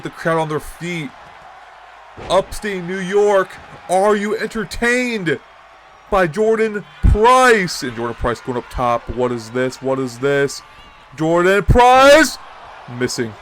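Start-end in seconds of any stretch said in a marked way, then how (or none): 2.3-7.8 hyping the crowd. Real, not acted. 11.2-12.4 big excited call of the name; excited shout. Real, not acted. High-flying move from the top.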